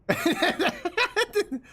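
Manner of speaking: shrill burst of laughter; high squealing laugh. Real, not acted. Streamer cracking up at the scene, high laugh.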